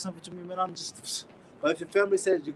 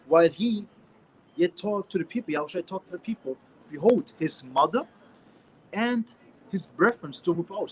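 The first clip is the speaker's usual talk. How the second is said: scripture reading; 5 dB louder than usual. Acted. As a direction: read the verse out like from the pulpit. Reads scripture aloud.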